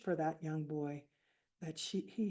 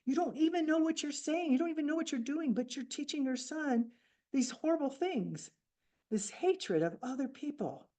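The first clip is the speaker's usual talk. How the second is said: high, louder pleading yell of a furious mother; a grieving widow yells at the killer's wife to stop; retold angry yell; 8 semitones higher, 4 dB louder than usual. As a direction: yell stop at her with raised pitch and rising anger. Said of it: "she just yelled at her and said".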